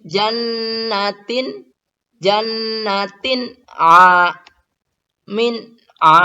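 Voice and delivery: "jannatin" slow and high; slow recitation. Shown as a demonstration. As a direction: clear tanwin before ain. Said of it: "bertemu dengan ain". (tanwin jelas pada "jannatin")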